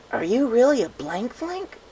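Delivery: high, slow piping filly voice. Acted. The pegasus filly blurts a question at Chaser, piping high. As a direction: blurt it out like a curious little pegasus. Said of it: "the Pegasus piped up suddenly".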